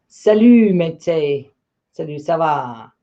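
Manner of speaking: a French "salut, ça va" with a French accent; French greeting. Real, not acted. Teacher greeting a French student in French, French accent. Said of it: "Mighty's from France".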